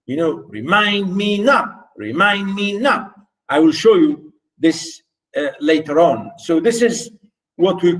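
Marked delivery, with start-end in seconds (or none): 0.5-3.1 "Remind me not" slowed into a higher, measured beat; metrical stress pattern. Shown as a demonstration. Teacher repeating the meter example, higher rhythmic chant. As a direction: scan "Remind me not" with raised pitch, landing on "not". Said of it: "Unstressed, stress, unstressed".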